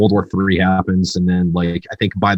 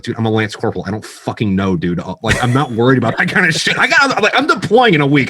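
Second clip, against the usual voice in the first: lance corporal shrugging; 6 semitones higher, 3 dB louder than usual. Retold or imitated. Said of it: "somebody else is like".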